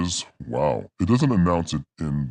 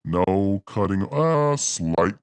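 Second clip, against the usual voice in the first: voice changer effect; 4 semitones higher than usual. Shown as a demonstration. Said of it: "six voice changing effects".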